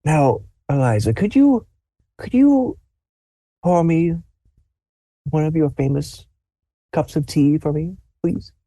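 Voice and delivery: put-on high, soft, tender old man; old grandfather. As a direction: ask sweetly like a frail grandpa. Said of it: "he goes".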